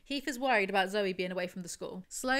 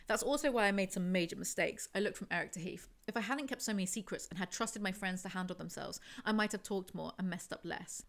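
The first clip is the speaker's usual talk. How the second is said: normal talking voice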